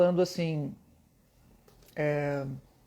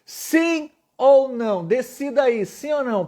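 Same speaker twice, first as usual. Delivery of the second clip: higher, louder, slow drawn-out question; emphatic call-out; 5 semitones higher, 4 dB louder, slower than usual. Shown as a demonstration. Teacher rallies his online students for an answer. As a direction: demand an answer from the class. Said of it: "a vida toda eu perguntei isso".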